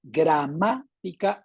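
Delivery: slow "Gramática", split after a stressed MA. Shown as a demonstration. Teacher marking the esdrújula, MA stressed in slow "Gramática". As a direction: lean on MA in "Gramática". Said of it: "es la esdrújula en la sílaba ma".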